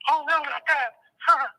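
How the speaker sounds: high, hammed-up mock alarm; sarcastic mock fear. Inmate mocking the threat of solitary, sarcastic. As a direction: pretend to be scared, dripping sarcasm.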